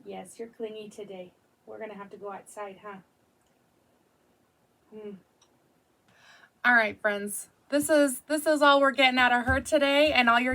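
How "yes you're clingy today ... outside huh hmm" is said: much softer murmur to the dog; hushed murmur. Real, not acted. Murmuring to the clingy dog, hushed. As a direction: say it low and close in her ear.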